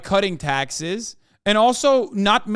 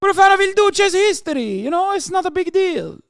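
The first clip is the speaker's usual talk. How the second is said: high, hammed-up Italian accent; mock Italian accent; 11 semitones higher than usual. Streamer mocking Italian nationalists: high hammed-up Italian accent.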